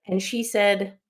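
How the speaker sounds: ordinary talk, nothing marked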